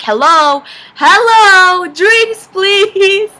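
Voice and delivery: high loud call for the bartender. Retold or imitated. Calling out to ignoring bartender.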